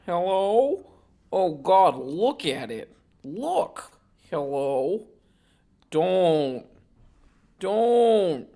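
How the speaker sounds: creepy voice. Acted. Acting out creepy recorded voices.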